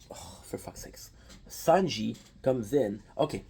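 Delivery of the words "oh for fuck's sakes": a slow, exasperated whispered curse; frustrated whisper. Real, not acted. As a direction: whisper it slowly to yourself, annoyed. Frustrated aside under breath.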